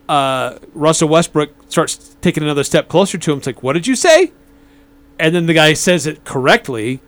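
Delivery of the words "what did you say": higher-pitched challenging question; mock confrontation. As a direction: imitate a player demanding an answer. Retold player confronting a heckler.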